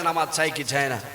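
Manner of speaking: quiet and low; low-key question. Real, not acted. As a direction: put the question softly to the congregation. First take before louder call.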